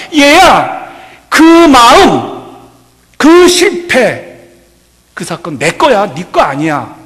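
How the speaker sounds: loud, very slow, tender call of the Lord; the Lord speaking. Acted. The Lord comforting his child, loud and very slow. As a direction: draw out each word as the Lord calling his child. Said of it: "주님이 분명히 그렇게 말씀하십니다".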